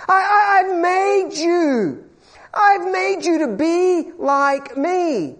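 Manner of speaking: slow, high, tearful cry as God. Acted. Preacher voicing God, crying out.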